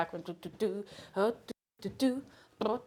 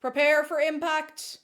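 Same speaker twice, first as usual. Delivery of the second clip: slow, higher, mock pilot warning; mock announcement; 6 semitones higher, slower than usual. Dropping the book, mock pilot warning. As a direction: warn like a pilot.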